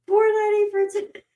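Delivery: high, fast squeak that breaks off; choked with laughter. Real, not acted. Streamer reading a price from chat, choked with laughter. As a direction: squeeze the words out through laughter. Trying to read through laughter.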